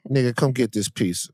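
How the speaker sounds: normal talking voice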